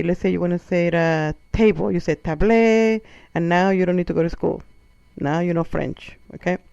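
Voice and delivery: ordinary talk, nothing marked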